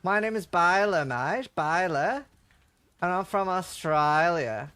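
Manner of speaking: put-on slow, drawn-out Australian accent. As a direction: put on a broad, unhurried Australian voice. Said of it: "you sound like you're from Australia".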